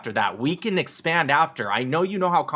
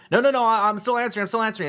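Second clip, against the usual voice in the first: mocking a debater; 8 semitones higher, faster than usual. Commentator mocks pro-Palestinian debaters, pitched-up flustered protest. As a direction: squeak out the interruption like a flustered debater. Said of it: "they want to".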